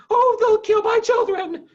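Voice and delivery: put-on a mother fearing for her children; frightened cry. A narrator's reading. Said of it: "cried Missus Fox".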